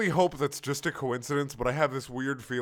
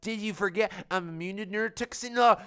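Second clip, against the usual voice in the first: mock taunt; 10 semitones higher, 4 dB louder than usual. [name] taunting.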